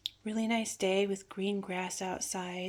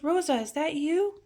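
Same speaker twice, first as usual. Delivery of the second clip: retold call, higher and a little louder; raised, questioning call; calling her name; 7 semitones higher, 5 dB louder than usual. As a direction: imitate grandpa calling out to check who's there. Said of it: "And he called my name".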